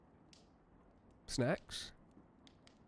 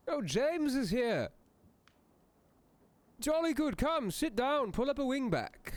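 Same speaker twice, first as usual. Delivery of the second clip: delighted welcome. Real, not acted. Host warmly welcoming James to the fireside, thrilled high voice. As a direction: greet him like a long-lost friend. Greeting a guest arriving.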